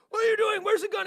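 high shouted demand; cops yelling. Retold or imitated. S1 voicing the swat cops, shouting. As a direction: yell it like an armed cop. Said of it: "and they're like".

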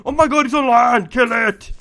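loud frantic shouting; panicked yell. Real, not acted. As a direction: yell it as the mob climbs out. Enemy comes on land, panics.

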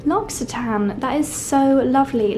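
ordinary talk

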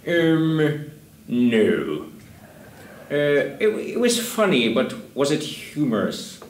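lightly voiced, hesitant producer; halting verdict with a drawn-out final question; Lorne Michaels. Director impersonating a producer at auditions, doubtful and halting. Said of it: "let me do my Lorne Michaels impression".

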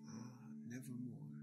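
usual speaking voice

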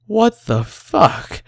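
high, drawn-out yell of disbelief; shocked yell. A narrator's reading. Injured man learning of his wound, shocked yell. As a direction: yell it slowly, pitch leaping up. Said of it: "I yell".

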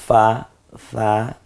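slow, careful ফা from lip and teeth; drawn-out fa. Shown as a demonstration. Teacher showing learners the fa sound, slow and drawn out. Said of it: "পেটের দিকে গিয়ে ফা".

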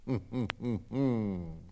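short mischievous monkey giggle; sly giggle. A narrator's reading. The monkey Fips giggling at his prank idea, sly giggle. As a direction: chuckle like a scheming little ape.

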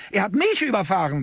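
high, angry shriek; furious screech. A narrator's reading. Old woman claiming the camera's attention, furious screech. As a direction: screech it in outrage. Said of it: "kreischte sie wütend".

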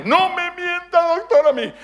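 very high, loud, drawn-out plea; hammed-up protest. Wife begging doctor not to lie, hammed-up protest. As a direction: cry it out in mock desperation.

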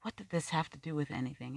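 ordinary delivery, nothing marked

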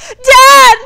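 very high, very loud scream; piercing, panicked child's cry; shriek. A narrator's reading. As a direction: shriek in terror for a parent. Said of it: "I shrieked".

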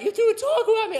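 high, loud indignant yelp; mock angry accusation. Angry driver accusing him over the taco, high mock outrage. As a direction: squeak it out like an outraged kid.

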